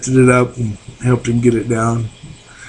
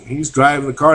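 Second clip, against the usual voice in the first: usual speaking voice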